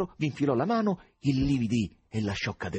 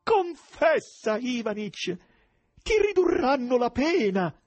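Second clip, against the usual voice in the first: high, loud, drawn-out wail; exclaiming plea; 6 dB louder, slower than usual. A narrator's reading. Wife begs her husband to confess, loud wailing exclamation. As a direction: cry out imploringly, stretching the words. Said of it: "esclamò".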